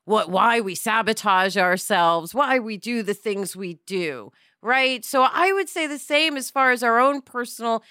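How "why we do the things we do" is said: normal talking voice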